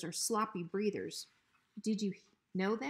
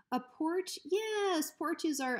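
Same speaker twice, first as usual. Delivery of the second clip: much higher, flustered agreement; nervous stalling; 9 semitones higher, 3 dB louder, much slower than usual. Acted. Hank nervously stalling Bruiser, much higher. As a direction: scramble for words.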